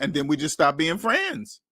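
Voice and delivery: ordinary talk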